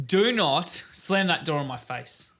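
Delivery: put-on dad scolding his toddler, slow and loud; stern scolding. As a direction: lay down the law to a defiant toddler, slow and loud.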